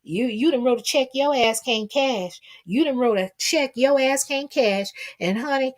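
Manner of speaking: usual speaking voice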